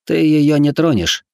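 slow, steady, confident; calm firm warning. A narrator's reading. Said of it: "как можно спокойнее и увереннее".

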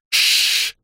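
sharp unvoiced shush; shushing hiss. A narrator's reading. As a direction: hush them with a hiss. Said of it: "шикнула она".

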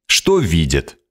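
plain reading voice

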